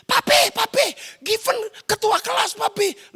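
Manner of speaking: put-on high, loud child bursting with news; thrilled kid reporting home; excited child. Acted child announcing news to father.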